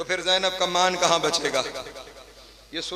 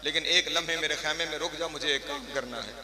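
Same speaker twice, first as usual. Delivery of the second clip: soft tearful plea; 2 semitones lower, 9 dB quieter than usual. Acted. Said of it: "रो के कहा".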